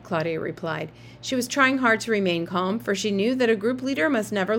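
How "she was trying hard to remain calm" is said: ordinary delivery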